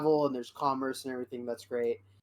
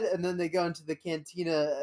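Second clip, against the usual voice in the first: ordinary talk, nothing marked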